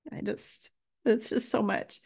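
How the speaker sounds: breathless, barely voiced; words catching in the throat; real tears close; overcome with emotion. Real, not acted. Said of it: "I'm getting emotional again".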